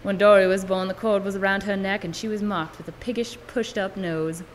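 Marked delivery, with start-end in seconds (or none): none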